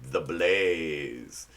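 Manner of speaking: very low, soft segment title; deep announcer voice. Pretend. Comic introducing the next segment, deep put-on voice. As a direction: drop right down for a drawn-out, mock-ominous title.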